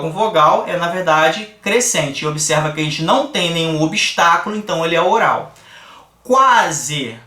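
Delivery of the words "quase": "Quase" com o a forte; teaching demo. Shown as a demonstration. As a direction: lean on the a of "Quase". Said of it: "olha só, o U com A".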